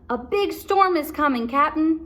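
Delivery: pirate warning the captain; worried pirate. Acted. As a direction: call it up high. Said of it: "said Pinky".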